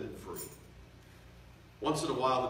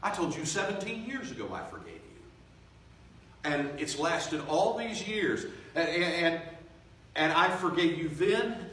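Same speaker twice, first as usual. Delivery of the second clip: Joseph pleading; 8 semitones higher, 6 dB louder than usual. Retold or imitated. Joseph voiced, crying disbelief.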